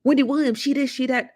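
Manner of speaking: high catty gossip voice; mock a messy gossiper. Vlogger mimicking messy gossip she avoids. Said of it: "you know".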